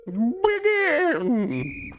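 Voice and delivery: very high, drawn-out squealed call; hammy high-pitched starting cry; announcer's starting call. Acted. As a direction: launch the contest in a squeal. Acted host starting the egg hunt.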